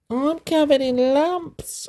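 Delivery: put-on high, slow small child's voice; a child explaining earnestly in a high voice. A narrator's reading. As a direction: speak up high like a little kid. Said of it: "announced Di at breakfast".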